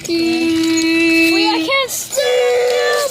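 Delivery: loud, slow stretched cry; drawn-out panicked yell. Real, not acted. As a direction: drag out the panic. Vehicle out of control.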